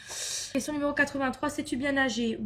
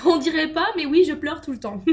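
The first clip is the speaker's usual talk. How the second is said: louder, laughing through it; laughing admission; 3 semitones higher, 7 dB louder than usual. Real, not acted. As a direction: giggle while owning up. Admits crying all the time, laughing.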